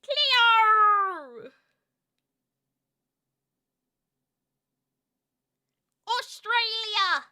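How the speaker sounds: high mock Australian, drawn out; Australian accent. Streamer chanting in a put-on accent, mock Australian. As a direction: stretch it in a hammed-up Australian accent. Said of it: "you got full Australian".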